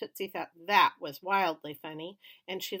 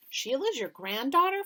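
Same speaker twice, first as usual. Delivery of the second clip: surprised; 8 semitones higher, 5 dB louder than usual. A narrator's reading. Boy surprised.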